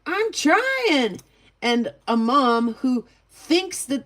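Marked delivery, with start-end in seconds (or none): 0.1-1.2 put-on high, strained kid protesting; a child whining back at mom, pitched high; whiny kid. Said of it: "And a kid who's like".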